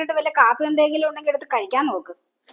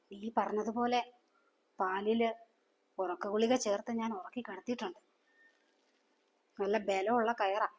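softer, conspiratorial murmur to her accomplice; hushed; 4 semitones lower, 13 dB quieter than usual. Acted. Mother to lover at night, hushed and conspiratorial. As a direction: keep it low and secret, slowing on the rope line. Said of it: "പയ്യെ പറ".

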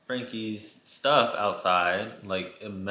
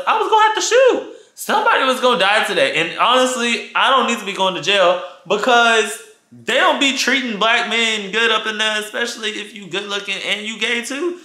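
excited dread; 9 semitones higher, 5 dB louder than usual. Real, not acted. Excited retelling, fear.

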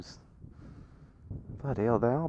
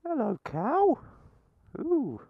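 high rising sing-song greeting; high cooing hello to the cow; 13 semitones higher, 4 dB quieter than usual. Acted. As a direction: coo it up high like to a pet.

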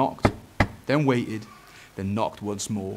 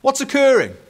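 high, loud inquiring call; 9 dB louder than usual. Acted. Dad asks son what is going on, calling out. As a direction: ask loudly as Dad, pitched up.

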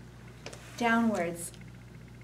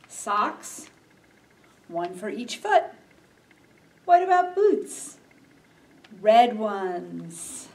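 put-on reading to children; slower than usual. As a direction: read gently to little ones. Reads a picture book aloud.

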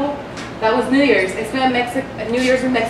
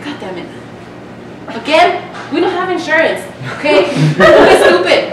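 put-on an annoyed mom losing patience with her kid; mock scolding that builds to a yell; exasperated mom; 4 semitones higher than usual. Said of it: "She's like".

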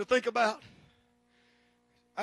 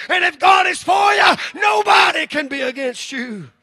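preaching at full force; 5 semitones higher, 11 dB louder than usual. Acted. Pastor telling the church not to fear death, full-force preaching. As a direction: proclaim it like a victory.

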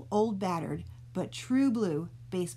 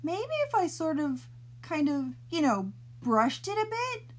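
lightly voiced small boy, higher, hesitant; a hopeful little boy thinking aloud; timid boy wondering; 8 semitones higher than usual. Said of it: "Oliver wondered".

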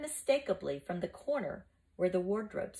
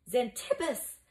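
startled cry; 5 semitones higher than usual. Acted. Boys find their teacher tied in the wardrobe, crying out. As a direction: cry out the name in amazement. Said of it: "the boys cried out".

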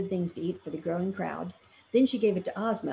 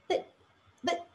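stammering; 10 semitones higher than usual. A narrator's reading. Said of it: "stammered the Scarecrow".

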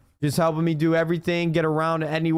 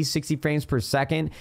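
ordinary talk, nothing marked

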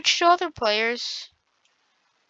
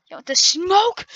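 hyped taunt; 7 semitones higher, 6 dB louder than usual. Real, not acted.

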